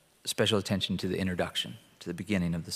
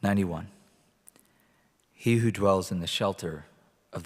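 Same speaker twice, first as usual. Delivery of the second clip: scripture reading. Acted. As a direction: read it out to the congregation. Reads Psalm 91 aloud.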